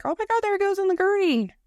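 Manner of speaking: high, fast, excited gasp at the TV; a fan watching a game, hamming up shock; mock shocked fan. Fan watching an injury on TV, high excited shock. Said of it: "you're like".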